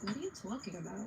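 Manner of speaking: put-on quoting sister in a New York accent; sister's New York accent. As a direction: say it like the sister, New York drawn vowel in "talking". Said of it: "my sister's like".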